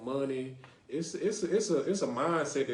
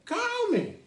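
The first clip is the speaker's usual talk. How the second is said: high, hammed-up pleading; half-laughing fake cry, pitched way up; mock whiny plea; 5 dB louder than usual. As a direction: beg the listeners to call like a jilted friend. Pleading with audience to call.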